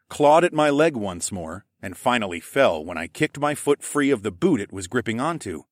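plain reading voice

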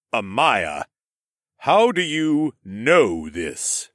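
slow, loud, deliberate lord; booming, measured big brother; pointed questioning. A narrator's reading. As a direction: draw the question out loudly for show. Lord questions sister, showing off.

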